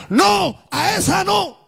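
high, slow shouted warning; commanding voice from beyond. Retold or imitated. Preacher voicing the voice heard at the graves, high commanding shout. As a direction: call it out long and high, like a voice stopping him. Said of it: "volvía esa voz y le decía".

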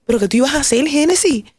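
high, rising disbelief at herself; self-reproach. Retold or imitated. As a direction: scold yourself in shocked disbelief. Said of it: "Y yo decía". (reproche a sí misma, incrédula y aguda)

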